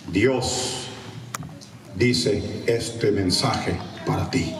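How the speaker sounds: slow, portentous pronouncement; super prophet. Retold or imitated. Self-styled prophet delivering message, slow and solemn. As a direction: pause weightily between words. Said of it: "super profeta".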